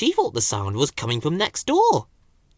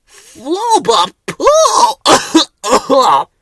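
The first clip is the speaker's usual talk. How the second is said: higher, very slow, loud yuck; disgusted gibberish; 13 semitones higher, 10 dB louder, much slower than usual. A narrator's reading. Ben echoing Bill about the smell, high disgusted gibberish. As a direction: gag on the stench.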